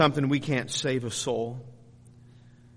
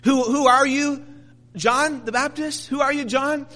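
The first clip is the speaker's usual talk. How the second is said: raised, high questioning voice; insistent questioners; 12 semitones higher, 6 dB louder than usual. Acted. Questioners press John the Baptist about who he is, insistent. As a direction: demand an answer, pitched high and loud.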